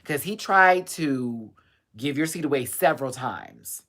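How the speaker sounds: ordinary talk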